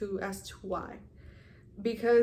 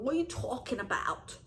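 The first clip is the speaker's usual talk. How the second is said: higher, put-on New Jersey accent; 4 semitones higher than usual. Shown as a demonstration. Vlogger showing viewers her slipped-out New Jersey accent. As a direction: lean into a New Jersey "tawk". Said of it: "my New Jersey accent wanted to come out".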